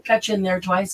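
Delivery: normal talking voice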